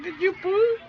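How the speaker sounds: high sing-song question. Real, not acted. Parent asks the baby about a diaper in high baby talk.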